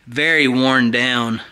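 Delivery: ordinary delivery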